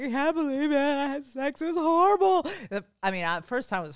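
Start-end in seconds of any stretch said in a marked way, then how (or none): 0.0-2.4 mock distraught. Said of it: "I'm like".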